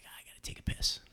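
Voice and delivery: a hint of the dad in a low whisper. Dad telling the hunters he needs a break, whispering. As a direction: whisper it like you're out in the bush. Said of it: "My dad's like".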